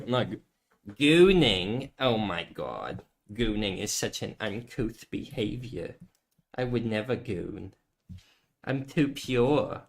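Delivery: lightly voiced prim prude; a hint of a pious snob; a mock-pure prude voice; prissy prude. Prim voice denying gooning.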